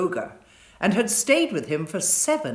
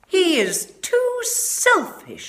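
much higher, slow, loud reproach; autumn's judgement; 6 dB louder, slower than usual. A narrator's reading. Autumn refusing the giant fruit, high and slow. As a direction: judge him with a high disdainful voice. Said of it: "she said".

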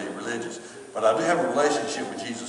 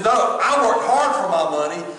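higher-pitched indignant protest, imitated; retold upset; 5 semitones higher than usual. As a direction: insist stubbornly, mimicking an upset relative. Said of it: "he really got upset".

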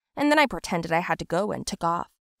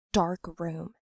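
plain reading voice

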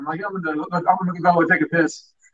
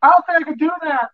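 high, mock-panicked whimper; panicky girlfriend; 10 semitones higher than usual. Mocking his high girlfriend, panicky and much higher. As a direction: play her scared and helpless. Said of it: "She's like".

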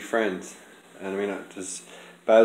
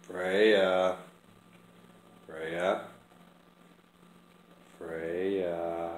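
soft call; 2 semitones lower, 3 dB quieter, much slower than usual. Real, not acted. Calling cat softly.

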